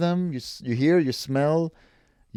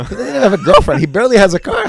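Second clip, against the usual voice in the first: teasing; 8 semitones higher, 9 dB louder than usual. Real, not acted. Teasing, laughing.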